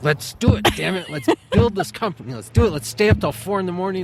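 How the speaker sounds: high, pumped-up rallying cries; hyped up. Retold or imitated. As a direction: fire up a partner for an all-nighter. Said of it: "hyperness and be like".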